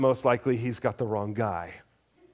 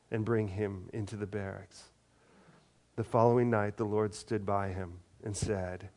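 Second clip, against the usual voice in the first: measured, lower reading voice for the lectionary; scripture reading; 4 semitones lower, 5 dB quieter than usual. A narrator's reading. Lector reads Acts to the congregation, measured and low. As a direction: read the passage steadily to the congregation.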